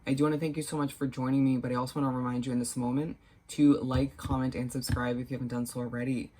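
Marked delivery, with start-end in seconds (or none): none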